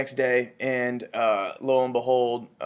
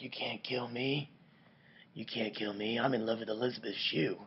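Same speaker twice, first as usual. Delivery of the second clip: voiced Montgomery; 2 semitones lower, 10 dB quieter than usual. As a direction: murmur it as a doomed man's mock defiance. Acted Montgomery pleading with attackers.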